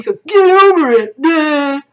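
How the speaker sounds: high, loud, exaggerated sneer; mocking scorn. Streamer reading a hostile comment, mocking scorn. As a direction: hurl it out as a hammed-up taunt.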